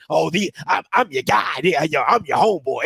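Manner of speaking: low, dopey buddy voice; mock homeboy voice. Imitating the coach as a low, dopey buddy. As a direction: play the fake friend, low and dopey. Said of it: "These your homeboys".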